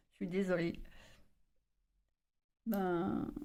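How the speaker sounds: breaking, hoarse voice; voice cracking. Real, not acted. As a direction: voice cracking on the words. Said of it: "J'ai la voix qui se casse".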